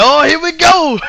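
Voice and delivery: high-pitched excited fan outburst. Retold or imitated. Guy behind him as the crowd erupts, excited. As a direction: imitate a crowd member crying out in excitement.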